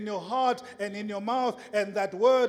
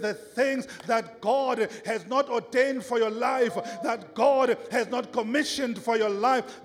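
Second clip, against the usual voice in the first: rising, insistent sermon build; impassioned preaching; 3 semitones higher, 6 dB louder than usual. Real, not acted. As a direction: drive each phrase home, getting louder.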